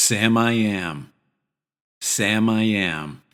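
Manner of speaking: Sam I am with open a; pronunciation demo. Shown as a demonstration. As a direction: say Sam I am slowly. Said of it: "How would you say it?".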